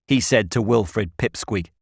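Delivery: ordinary delivery, nothing marked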